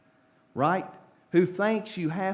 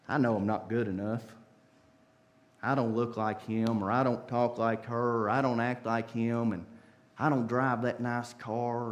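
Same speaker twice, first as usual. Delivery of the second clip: insecure murmur; 9 semitones lower, 6 dB quieter than usual. Acted. Insecure person's prayer.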